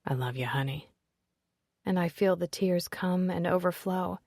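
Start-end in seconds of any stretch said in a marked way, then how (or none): 0.0-0.9 soft, low whisper in her ear. Acted. Father whispers to his daughter while hugging, soft whisper. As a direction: breathe it close to her ear. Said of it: "whispers in my ear".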